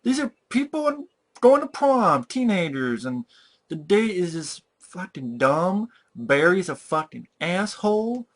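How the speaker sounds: can't believe they buy it; high pitched ranting at the screen; exasperated disbelief. Retold or imitated. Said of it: "I'm like".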